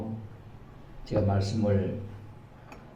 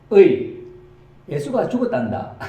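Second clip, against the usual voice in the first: put-on loud, high Gyeongsang old woman calling out the news; Gyeongsang grandma; 8 semitones higher, 7 dB louder than usual. Grandma announces to friends that Jesus died, in Gyeongsang dialect. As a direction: shout it like a country granny from Gyeongsang. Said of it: "한 할매가".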